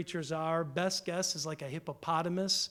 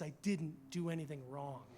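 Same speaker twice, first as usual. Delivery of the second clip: much softer, steady denial; hushed, firm Job; quiet insistence; 3 semitones lower, 9 dB quieter than usual. Retold or imitated. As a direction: say it low and sure. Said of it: "And he says".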